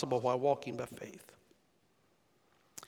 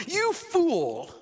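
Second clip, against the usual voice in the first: high, loud hammed-up yell; over-the-top shout of You fool; mock furious scream; 12 semitones higher, 6 dB louder than usual. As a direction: scream it at Peter like a furious master. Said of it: "as he screamed at him".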